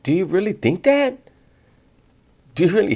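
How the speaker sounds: high-pitched mimicry of a pleading question; mock-whiny, high-voiced quote; mocking imitation. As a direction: parrot it back high and incredulous. Mocking what the host said.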